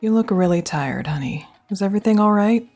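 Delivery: usual speaking voice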